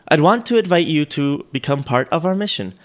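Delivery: failed German accent that came out British; British Siri accent. Shown as a demonstration. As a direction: read it like a British voice assistant. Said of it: "British Siri".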